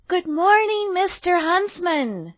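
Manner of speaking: put-on high, bright-loud little girl greeting; cheerful girl greeting. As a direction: call out a cheery good morning.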